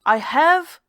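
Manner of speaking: triumphant loud yell. Acted. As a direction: scream it in triumph. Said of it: "I yelled".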